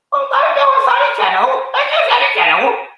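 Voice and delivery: high, mocking impression of a timid youtuber; ridiculing falsetto; mocking whiny voice. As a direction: squeak it out, laughing at him. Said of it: "You, you mugs".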